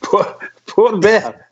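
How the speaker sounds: stumbling over the name through real laughter; slow, giggling attempt at a name; laughing. Real, not acted. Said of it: "I can't say it for laughing".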